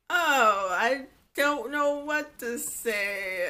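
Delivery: slow, drawn-out reluctant reaction; stretched-out, half-hearted dismay; mock unexcited mother. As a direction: imitate a mom who is not excited, dragging the words. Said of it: "she was not excited".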